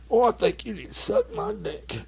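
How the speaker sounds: high, taunting falsetto come-on; high mocking voice. Buddy taunting the big guy, high mocking falsetto. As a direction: squeak it out like a cocky drunk daring him. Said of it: "He says".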